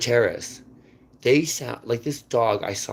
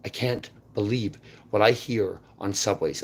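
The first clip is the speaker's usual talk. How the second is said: ordinary talk, nothing marked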